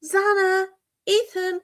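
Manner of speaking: quoting his shout back, high and incredulous; imitated shouting. Host mocking Hunter's shout to Susie, imitated calling out. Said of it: "why are you shouting".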